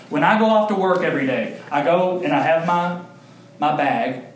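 ordinary talk, nothing marked

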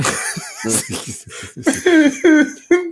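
high cackling evil laugh; machiavellian laugh. Acted. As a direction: cackle like a scheming villain. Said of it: "C'est un rire machiavélique de [name]".

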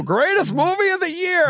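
teasing a friend's horror-film rave; mock rave. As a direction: gush it like an overexcited fan. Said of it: "could take Evil Dead Rise and go".

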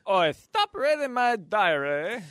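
high, slow, bad British accent. Friend retries the Bridget Jones line, in a clumsy British accent. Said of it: "She needs a British accent".

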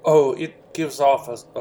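ordinary talk

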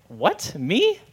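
disbelieving, pitch leaping up on 'Me'; mock innocence. Someone told they are self-righteous, mock innocent surprise. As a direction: act surprised to be accused. Acts out the accused's denial.

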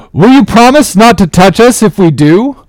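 high-pitched, forceful challenge; demanding ringleader. A narrator's reading. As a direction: demand a promise from the captain. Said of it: "demanded their ringleader".

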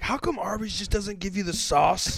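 high, loud comic complaint; loud mock indignation. Re-enacting busting into a friend's apartment, loud mock indignation. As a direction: burst in with an urgent petty grievance.

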